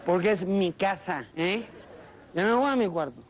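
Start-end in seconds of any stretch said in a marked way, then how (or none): 2.3-3.2 a hint of a sulking kid; sulky teen. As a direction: storm off to your room. Sulking teen exit, other voice.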